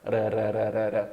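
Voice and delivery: ra repeated in a rapid tapped chain; Brazilian tapped R. Shown as a demonstration. Teacher demonstrates flap T, a run of tapped ra. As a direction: tap the tongue on every ra. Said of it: "like the Brazilian R".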